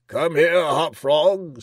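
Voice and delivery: commanding king summoning his jester, high and loud; jowialny, władczy król. A narrator's reading. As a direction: call out grandly as a tyrant king.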